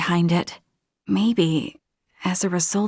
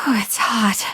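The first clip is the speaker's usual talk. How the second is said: exhausted whisper after a sigh. Acted. Sweltering in the heat, sighing exhausted whisper. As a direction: wilt in the heat.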